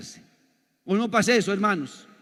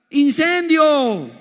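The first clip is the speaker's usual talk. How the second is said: stretched-out loud, high cry of fire; shouted punchline; 6 semitones higher, 9 dB louder, much slower than usual. Acted. Preacher shouting ¡Incendio! to the congregation, loud and drawn out. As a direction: blast the word ¡Incendio! slowly and loud like an alarm. Climax of the car bomb joke.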